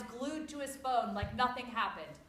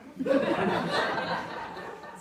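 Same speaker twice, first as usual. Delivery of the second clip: loud burst of laughter; 5 semitones higher, 7 dB louder than usual. Real, not acted.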